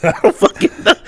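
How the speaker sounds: laughs through it, voice jumping high; laughing. Real, not acted. Laughing as he admits to his friend he has no clue. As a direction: bursts out laughing mid-sentence.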